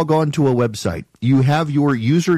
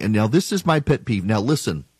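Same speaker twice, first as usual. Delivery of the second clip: normal talking voice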